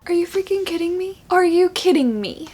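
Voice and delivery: high, disbelieving cry; can't believe what just happened; stunned outrage. Real, not acted. Reader shocked by a plot twist, disbelieving.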